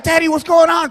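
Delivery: high-pitched urgent question; mock panic at a choking friend; alarmed.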